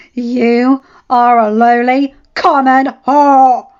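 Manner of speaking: slow, very loud yell; wife yelling at her rival; yelled insult. Acted. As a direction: bellow each word of the insult. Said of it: "Catelyn yelled".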